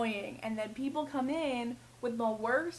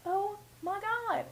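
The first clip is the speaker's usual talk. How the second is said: exasperated; 8 semitones higher, slower than usual. Real, not acted.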